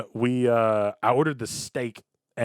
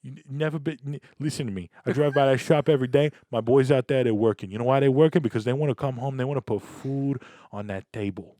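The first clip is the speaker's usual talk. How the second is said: Italian dad; 4 semitones higher than usual. Pretend. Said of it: "is this how Italian men".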